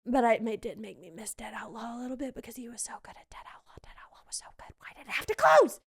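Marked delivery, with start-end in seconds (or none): 2.3-4.7 breathy, whispered lament; hushed fan lamenting a loss; wistful whisper. Pretend. Lamenting a show that closed. 4.8-5.8 hammed-up lament bursting out at the end; mock anguish. Lamenting a show that closed.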